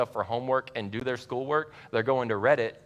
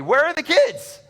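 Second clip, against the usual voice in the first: high, loud re-enacted scream; screaming demand; 10 dB louder than usual. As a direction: scream it like someone storming in. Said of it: "Like, screaming it".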